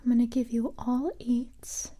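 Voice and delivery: normal talking voice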